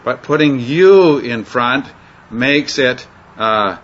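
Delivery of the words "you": "you" lifted higher and louder; emphatic you. Shown as a demonstration. Teacher stressing "you" as emphatic Greek. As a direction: punch the word "you". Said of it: "very emphatic".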